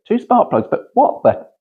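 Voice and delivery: high, puzzled mock voice of a confused bystander; high baffled "what the?"; baffled onlooker. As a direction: squeak it out like someone who can't believe two plugs. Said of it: "people go".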